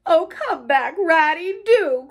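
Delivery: pleading cry. A narrator's reading. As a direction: call your friend back, begging. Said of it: "cried the Mole".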